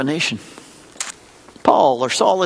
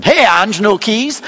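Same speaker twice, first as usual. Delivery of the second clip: playful louder greeting, teasing a friend; silly call-out; 4 semitones higher, 4 dB louder, slower than usual. Retold or imitated. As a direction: call out cheekily to a coworker. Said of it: "I'm being kind of silly".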